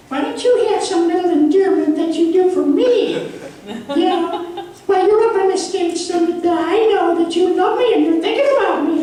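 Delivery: high, sugary mock voice of the wife. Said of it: "She doesn't really talk like that".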